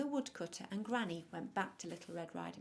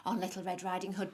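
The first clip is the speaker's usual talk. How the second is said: ordinary delivery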